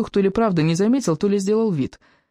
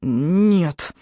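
groaned, mumbled refusal; muffled mooing. A narrator's reading. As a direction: moan it through a squashed mouth. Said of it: "мычал Икинг".

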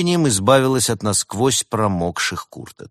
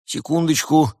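higher-pitched, fussy reply; bustling; 7 semitones higher than usual. A narrator's reading. Host hurries to make tea for guests, bustling. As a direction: say it as a fussing host. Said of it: "засуетился хозяин".

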